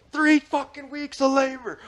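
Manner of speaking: slow, very high-pitched mock yell; retold outrage. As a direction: stretch it out in imitated fury. Retold contractor raging over pay.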